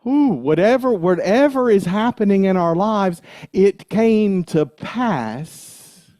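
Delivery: high, loud, rising preacher's cry; excited preaching. Real, not acted. Minister getting excited preaching to the congregation, high and loud. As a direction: lift the room with it.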